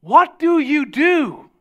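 high, loud, drawn-out question; inquisitive stranger. Retold or imitated. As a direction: imitate a nosy stranger asking very slowly. Stranger's dreaded next question, voiced.

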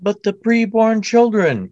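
high, slow, mock pious whine; sarcastic mock concern. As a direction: sneer it like a pious zealot. Sarcastic anti-abortion voice.

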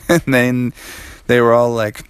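ordinary delivery

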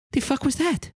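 put-on high, baffled young woman; tiefling reacting to a failed divine intervention. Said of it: "She goes".

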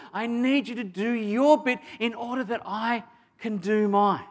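rising to a loud, high, fervent appeal; impassioned plea. Acted. Jesus urging John to help him, loud and fervent. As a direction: pour urgency into it, pleading with a friend.

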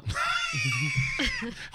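high shrieking burst of laughter. Real, not acted.

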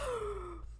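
sharp high surprised gasp. Real, not acted. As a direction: gasp in shock. Gasp at coffee stain.

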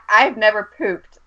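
ordinary talk

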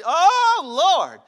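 high, loud laughing cry of disbelief; real laugh breaking through; laughing exclamation. Real, not acted. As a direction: burst out laughing at the absurdity. Preacher exclaims at human bargaining.

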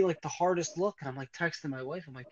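usual speaking voice

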